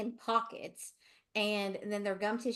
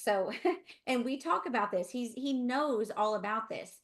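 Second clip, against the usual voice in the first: usual speaking voice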